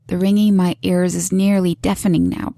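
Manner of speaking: ordinary delivery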